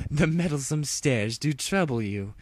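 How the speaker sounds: lightly voiced regal Luna.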